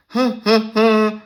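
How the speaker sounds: high, mocking huh-huh-huh; taunting high chuckle; mocking laugh. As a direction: sneer out a little laugh at him. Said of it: "I'm making a mocking face".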